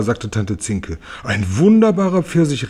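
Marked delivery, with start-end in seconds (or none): 1.2-2.7 höher und lauter gerufen; Ausruf. A narrator's reading. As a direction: ruft begeistert aus. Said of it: "rief Tante Schwamm". (lauter, höherer Ausruf)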